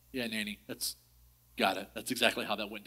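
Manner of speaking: sheepish reply. Retold or imitated. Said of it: "I'm like".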